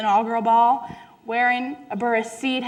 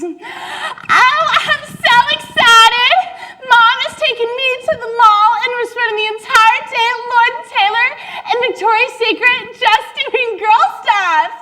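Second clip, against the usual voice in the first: put-on very high, giddy preppy girl voice, giggling; gushing teen girl; 13 semitones higher, 7 dB louder than usual. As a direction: gush about the mall like a spoiled girl. [name] gushing about mall trip.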